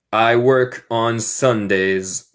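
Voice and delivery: slow, each word held; slow teaching model. Shown as a demonstration. Teacher models English word order, slow clear delivery. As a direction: give each word its own space.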